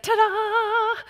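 put-on high, bright kid's ta-da. Dad voicing son Judah's ta-da in costume, high. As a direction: announce yourself like a proud five-year-old. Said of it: "and he said".

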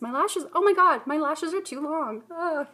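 high, playful fake whine; mock complaint. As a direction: pretend it's a tragedy. Mock complaint about long lashes.

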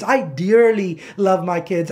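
"dearly" drawn out with force; slow, emphatic weight on "dearly"; stress on DEARLY. Shown as a demonstration. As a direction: lean hard on "dearly". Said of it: "The emphasis on that word so".

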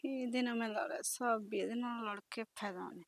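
muttering to herself so her sister-in-law won't catch it; under her breath. Acted. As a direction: grumble it so she can't hear. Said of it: "ਉੱਚੀ ਬੋਲ ਲੈ".

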